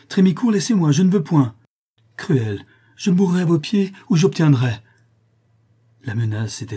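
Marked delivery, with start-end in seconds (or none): 0.1-1.6 pleading cry. A narrator's reading. Mélite resists him. 2.2-4.7 passionate outburst. A narrator's reading. Trémicourt's passionate threat.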